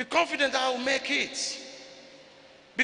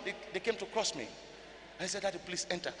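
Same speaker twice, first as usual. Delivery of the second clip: hushed telling; 3 semitones lower, 11 dB quieter, faster than usual. Real, not acted. Drops into hushed storytelling.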